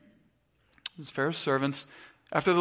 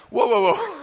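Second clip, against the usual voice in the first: high, loud mock alarm; alarmed objection; 6 semitones higher, 6 dB louder than usual. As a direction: act out putting the brakes on a friend. Retold alarm at speeding chase.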